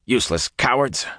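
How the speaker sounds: contemptuous growl. A narrator's reading. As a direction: spit it out with scorn. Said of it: "Masema growled".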